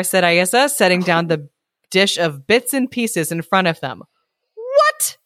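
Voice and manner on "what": high, whiny squeak of a spoiled dog; a spoiled pet whining for dinner. Acted. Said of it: "putting on my best whiny voice".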